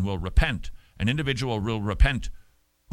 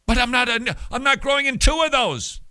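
whiny protest; 13 semitones higher, 6 dB louder than usual. Retold or imitated. Voicing a doubting listener's protest.

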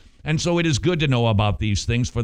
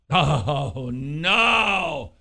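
mock alarm; 2 semitones higher than usual. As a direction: pretend horror at sounding sappy. Mock dread at getting sappy.

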